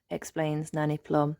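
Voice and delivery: plain reading voice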